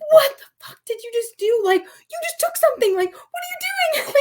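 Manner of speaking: high-pitched, shocked self-scolding; self-scolding outburst. Retold or imitated. Alone in the car after grabbing the visor, high shocked self-scolding. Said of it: "like, out loud".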